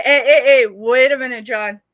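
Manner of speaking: normal talking voice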